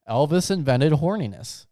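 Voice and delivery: normal talking voice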